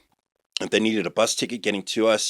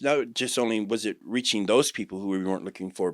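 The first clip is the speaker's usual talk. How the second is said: normal talking voice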